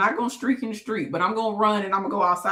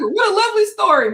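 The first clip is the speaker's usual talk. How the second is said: playful gushing; 12 semitones higher, 6 dB louder than usual. Real, not acted. Echoing praise of her story.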